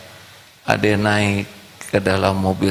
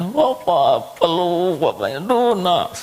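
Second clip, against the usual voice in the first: put-on high tearful kid voice; child's voice; 10 semitones higher than usual. Boy hugs his father, high child voice. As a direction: cry to dad like a boy.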